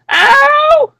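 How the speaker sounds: high-pitched drawn-out "ow"; imitated scream. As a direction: mimic a woman's odd yelp of pain. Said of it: "start screaming 'cause she got shot".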